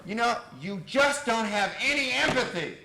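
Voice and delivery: loud, high acted complaint; angry accusation. Client accusing the therapist, loudly indignant.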